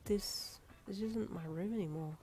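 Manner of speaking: soft, hushed sad thought; half-whispered realisation. A narrator's reading. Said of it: "you thought sadly".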